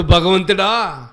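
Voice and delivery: pig crying out. Retold or imitated. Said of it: "అంటుంది".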